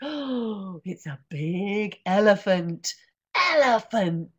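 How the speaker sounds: slow, loud, awed; excited reveal. Acted. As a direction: savour the big word. Reveal of page.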